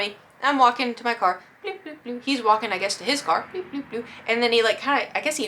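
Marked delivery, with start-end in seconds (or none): 1.6-2.2 high, soft walking sound effect; playful bloop noises. Retold or imitated. Imitates walking along. 3.5-4.0 high, soft walking sound effect; playful bloop noises. Retold or imitated. Imitates his walking.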